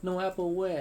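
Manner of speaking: loud, high alarmed cry; cried out. Acted. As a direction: cry out to stop a fall. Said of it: "I cried".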